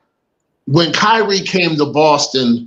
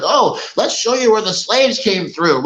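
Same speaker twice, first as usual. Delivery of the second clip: higher, bright mock tour-guide pitch; 4 semitones higher than usual. Mocking a Freedom Trail tour guide, higher. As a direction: sell it like a cheery guide.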